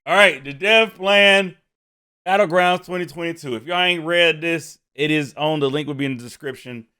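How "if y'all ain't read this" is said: ordinary talk, nothing marked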